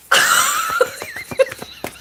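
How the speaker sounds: a real squeal of a laugh, very high. Real, not acted. Reader laughing at a greedy viewer comment, high squeal. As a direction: burst into a high shrieking giggle.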